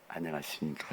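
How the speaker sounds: low, soft, bashful hello; timid and under the breath; shy greeting. Acted. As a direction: mumble a greeting as if too shy. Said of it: "말 한마디 안 하고 수줍어가지고".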